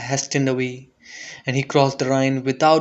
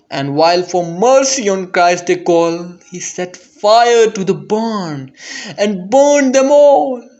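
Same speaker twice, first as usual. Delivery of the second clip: builds louder and higher, slowing at the end; rising dread; 4 semitones higher, 5 dB louder than usual. A narrator's reading. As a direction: climb to a cry on burned them all. Bishop burns the poor alive.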